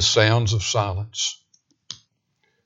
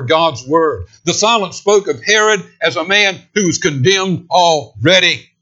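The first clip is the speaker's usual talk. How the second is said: higher, building pulpit cry; preaching at full force; 6 semitones higher, 4 dB louder than usual. Acted. Preacher denouncing Herod to the congregation, at full force. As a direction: preach it, climbing in pitch.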